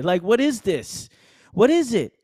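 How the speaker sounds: rising loud disbelief; incredulous. Real, not acted. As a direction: throw up your hands, baffled. Incredulous rant.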